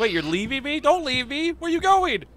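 rising, high-pitched protest; alarmed pleading. Pretend. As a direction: plead as if being abandoned. Buddy signs off, player protests.

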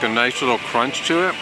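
ordinary delivery

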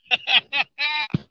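gleeful run of laughter; delighted laugh. Real, not acted. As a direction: laugh happily at your luck. Delighted while fighting the fish.

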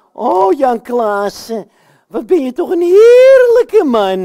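slow swoon rising to a high, loud squeal; gushing admiration. Pretend. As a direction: gush over your wonderful husband. Katrijn dweept met haar man. (dwepend, hoog uitgehaald)

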